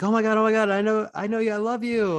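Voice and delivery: imitating a fan: high, squealing gush; hyper excited fan. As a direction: squeal it like a starstruck fan at the games. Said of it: "she's hyper excited".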